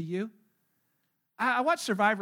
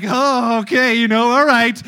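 much louder, flustered surprise; startled parents; 16 dB louder, slower than usual. Retold or imitated. Preacher voicing parents startled by daughter's question, loud. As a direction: blurt it out, caught off guard.

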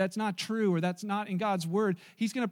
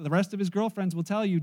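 ordinary delivery

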